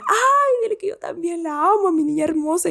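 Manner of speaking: high, loving granny voice on the phone; doting grandmother. Retold or imitated. Grandmother on the phone sending love to her granddaughter, high and doting. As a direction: gush like a proud grandma.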